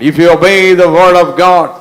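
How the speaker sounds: emphatic repeat. Real, not acted. Preacher driving a key truth home to the congregation, loud and emphatic. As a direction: proclaim it with full force, drawing out each word.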